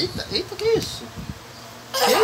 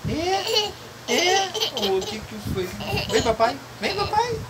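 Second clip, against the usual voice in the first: put-on much higher sing-song baby talk. Father calling the baby over in high baby talk. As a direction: coax the little one in a squeaky voice.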